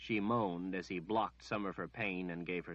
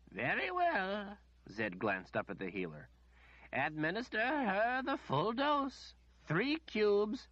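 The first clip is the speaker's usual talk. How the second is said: a lightly voiced, patient old wizard; the wizard calmly answering the demanding lady; 13 semitones higher than usual. A narrator's reading. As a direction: humour her politely and slowly. Wizard orders healer.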